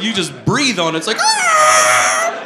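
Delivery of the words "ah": high, loud screeching wail; drawn-out high shriek. Retold or imitated. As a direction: squeal like a car swerving at a touch. Imitates car lurching when breathed on.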